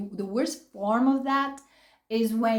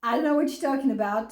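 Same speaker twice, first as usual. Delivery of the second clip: fast throwaway denial; imitating the ex's hurried denial; rushed mock denial; 2 semitones higher, much faster than usual. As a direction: spit it out quickly to dismiss. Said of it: "it goes from".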